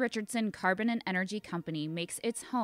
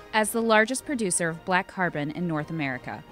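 hard sell ad; 6 dB louder than usual. Acted.

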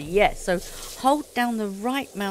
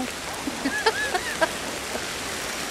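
nervous laugh; 4 dB quieter than usual. Real, not acted. Laugh after the scare.

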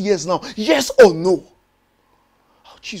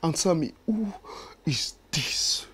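hushed demand; 6 semitones lower, 11 dB quieter than usual. Acted. Tense late-night confrontation.

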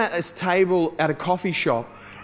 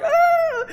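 high-pitched mock wail; retold crying wail; imitated crying; 3 dB louder than usual. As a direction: let out a high imitated sob. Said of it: "just started crying".

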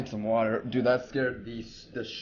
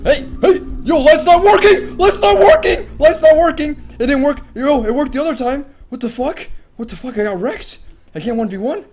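higher, much louder frantic yelling; real panic, yelled; panicked yelling; 14 dB louder than usual. Real, not acted. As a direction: shout for help as the light fails. Panicking, light failing in fight.

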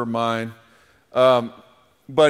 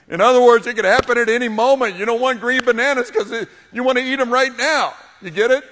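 much higher and louder, bursting out with the joke; excited punchline; 10 semitones higher, 7 dB louder than usual. Real, not acted. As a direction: sell the green bananas joke with big energy. Punchline explained after his joke.

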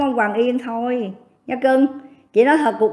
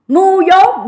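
spat insult; 6 semitones higher, 5 dB louder than usual. Real, not acted. Angry rant, repeated insult.

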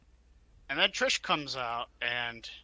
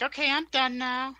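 much higher mock female wrestler voice; Mickey James; 12 semitones higher, 3 dB louder than usual. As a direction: imitate her in a high put-on voice. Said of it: "Mickey was like".

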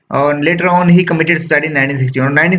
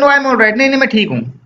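put-on higher, boyish insisting voice; child's voice; 4 semitones higher than usual. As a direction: play a nine-year-old brushing off worry. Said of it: "तो फिर बच्चा बोलता है". (बीमार बच्चा पिता से कहता है, ऊँची बच्चे जैसी आवाज़)

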